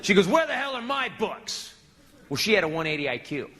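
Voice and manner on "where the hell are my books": higher, drawn-out mock outrage; acted angry wife. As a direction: demand it slowly like an irate wife.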